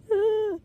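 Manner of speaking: short, high put-on sound; stoned giggle noise. Retelling what he said to friends, high silly noise. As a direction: let out a little high-pitched "hee".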